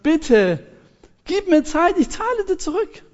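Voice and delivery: pleading servant. Acted. Servant begs creditor on knees. (flehend, mit hoher Stimme)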